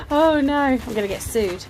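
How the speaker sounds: laughing through the words. Real, not acted. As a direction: giggle it out in mock worry. Laughs at own remark.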